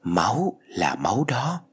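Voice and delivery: lightly voiced ghost, slow and drawn out; a hint of a haunting, slow ghostly voice; eerie voice at the ear. A narrator's reading. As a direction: draw each word out like an otherworldly voice. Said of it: "Có giọng nói ghé sát vào tai".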